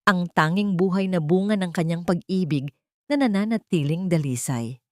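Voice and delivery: ordinary delivery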